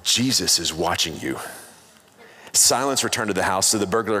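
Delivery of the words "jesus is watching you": low voice from the dark, lightly played; mildly ominous, low and no louder than usual; hint of a mysterious booming voice; mock booming voice. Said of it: "he heard a loud voice say".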